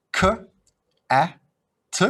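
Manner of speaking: k, a, t each sound said apart with pauses; sounding out words. Shown as a demonstration. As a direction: sound out k, a, t one by one. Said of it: "the boy is going to sound out each letter".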